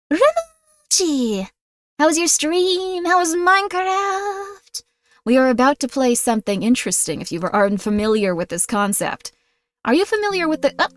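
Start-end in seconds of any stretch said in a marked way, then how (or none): 0.1-4.8 high, slow drawn-out hello; excited high greeting. Real, not acted. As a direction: call out their name high and slow. Greeting a raider.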